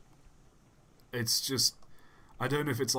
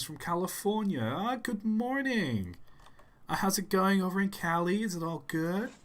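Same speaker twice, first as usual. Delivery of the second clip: exaggerated Cali accent, much higher; put-on Californian accent; 10 semitones higher than usual. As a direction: greet them like an over-friendly Californian. Puts on Californian accent for viewer.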